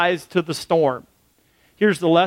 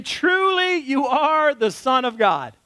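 worshipping disciples; 7 semitones higher than usual. Retold or imitated.

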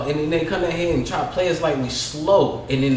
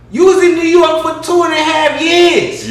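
loud, much higher, laughing disbelief; higher, louder mocking rant at a poser; incredulous shout; 10 semitones higher, 8 dB louder than usual. Real, not acted. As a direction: call him out loudly, half laughing. Mocking a fake New Yorker.

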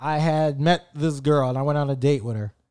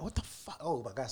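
softer, breathless whisper; whispered fear; 4 semitones lower, 18 dB quieter than usual. Retold or imitated. Realising the ex plays football, frightened whisper. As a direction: whisper it as if he might hear.

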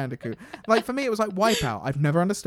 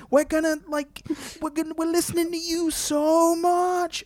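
mock hype; 7 semitones higher than usual. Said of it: "and then he goes".

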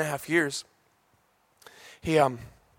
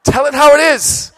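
emphatic shout; 11 semitones higher, 14 dB louder than usual. Real, not acted. Emphatic exhortation to Aussies.

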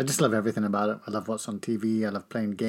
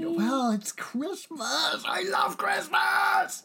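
excited; much slower than usual. Acted. Mock excited Christmas cheer.

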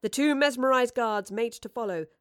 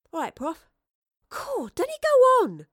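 put-on high, loud girlish grumble; 12 semitones higher, 8 dB louder than usual.

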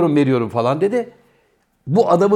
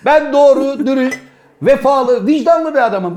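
high, raised declamation to an audience; hammed-up self-righteous address; mock indignant proclamation; 6 semitones higher, 4 dB louder than usual. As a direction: plead your virtue like a public speech. Mock public complaint to the guests.

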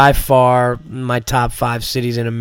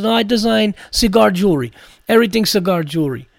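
Armenian accent impression; 5 semitones higher, faster than usual. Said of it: "me and Matt Reese were imitating this guy".

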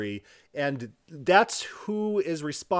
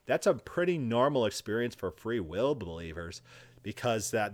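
normal talking voice

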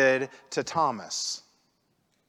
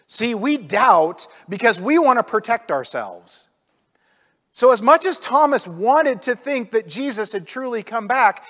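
ordinary delivery

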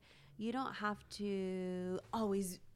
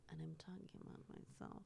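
secret whisper, no voice; whispered aside; 3 semitones lower, 14 dB quieter, faster than usual. Real, not acted. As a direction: confess it under your breath. Confessional aside.

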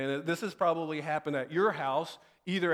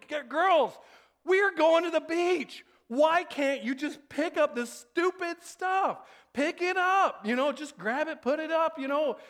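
upset dad; 10 semitones higher than usual. Retold or imitated. Dad scolding daughters over mess, borderline yell. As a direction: scold the girls to pick up, near yelling. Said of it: "tone of my voice grows deeper and more authoritative".

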